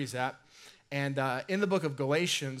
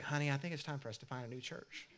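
hushed aside to spouse; 9 dB quieter, faster than usual. Pretend. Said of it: "you'd lean over and you'd be like".

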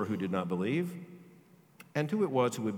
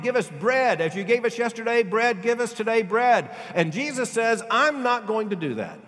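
full-force preaching; 8 semitones higher, 7 dB louder than usual. Acted. Preacher to his congregation, full-force preaching. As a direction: preach it out with fire.

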